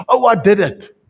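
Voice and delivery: sudden loud dismayed cry, high. Acted. Cindy realising she just sinned, loud dismayed cry. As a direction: blurt it out in horror.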